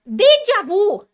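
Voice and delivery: high, drawn-out full shout; loud escalating meltdown; ranting breakdown. Real, not acted. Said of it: "I'm having a fucking mental breakdown".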